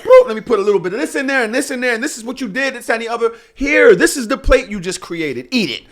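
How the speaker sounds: animated imitation of a woman serving up blame; mock piling-on. Acting women piling up grievances.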